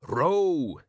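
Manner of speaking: high, drawn-out shout; father's urgent bellow; shouted order. Acted. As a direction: holler the order to your son. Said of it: "his father shouted".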